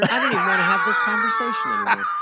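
protesting through real laughter at an awkward topic; laughing outburst. Real, not acted. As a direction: laugh it out in mock exasperation.

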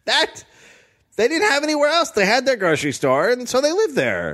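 lightly voiced whiny child; high little kid pleading. Playing a child in store.

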